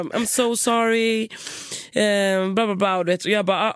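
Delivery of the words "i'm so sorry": very slow, high grovelling "I'm so sorry"; apologetic receptionist. Retold or imitated. Receptionist begging forgiveness, slow and high. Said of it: "Han bara".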